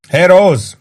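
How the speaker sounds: high, loud call across the room; bellow. A narrator's reading. Warden summons Rose from another table, bellowing. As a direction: bellow for the barmaid. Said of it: "bömbölte Warden".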